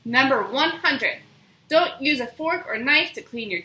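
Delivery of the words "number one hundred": ordinary delivery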